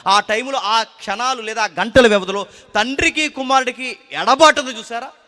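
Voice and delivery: loud, high, fervent preaching; full-force preaching. Acted. Preacher thundering to the congregation about fear, loud and fervent. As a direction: drive the point home to the congregation. Preacher explaining separation loudly.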